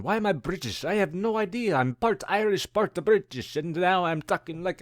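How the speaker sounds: high, loud mock-British voice; Irish attempt sliding into British; accidental British accent. Shown as a demonstration. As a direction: put on a confused, high-pitched British accent. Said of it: "I turned British all of a sudden".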